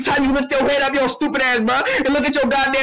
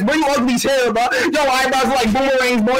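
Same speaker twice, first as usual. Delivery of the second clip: ordinary talk, nothing marked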